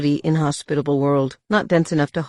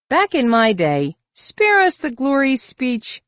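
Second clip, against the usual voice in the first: mocking rival; 10 semitones higher, 6 dB louder, slower than usual. Talion's mocking interruption.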